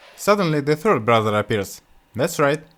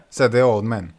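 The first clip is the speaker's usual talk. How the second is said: ordinary delivery, nothing marked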